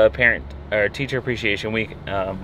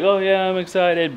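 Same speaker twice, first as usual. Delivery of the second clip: high-pitched imitation of a kid's put-on enthusiasm; mock-excited kid, not genuine; feigned excitement; 9 semitones higher, 3 dB louder than usual. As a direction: mimic a kid claiming to be excited. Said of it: "but he actually sounds excited".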